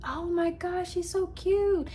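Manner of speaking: high, gushing neighbor voice up top. Retold or imitated. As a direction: coo over the kitten like the neighbor did. Neighbor gushes over kitten.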